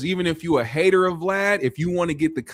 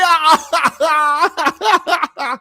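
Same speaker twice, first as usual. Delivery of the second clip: high put-on laugh trailing off; trying out a silly laugh; mock Vlad laugh; 5 dB louder than usual. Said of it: "is that how his laugh goes?".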